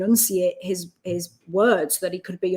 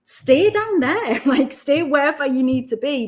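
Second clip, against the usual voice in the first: laughing dad quote; 6 semitones higher, 4 dB louder than usual. Retold or imitated. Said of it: "he's like".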